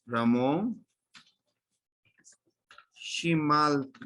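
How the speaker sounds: Ramón, shimalti said slowly, each syllable clear; teacher modelling shimalti slowly; slow model command. Shown as a demonstration. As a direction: spell out shimalti slowly for the class. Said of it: "A ver, miren".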